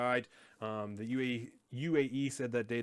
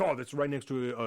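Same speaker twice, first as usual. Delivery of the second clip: mock panicked leaders; 9 dB louder than usual. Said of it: "They're like".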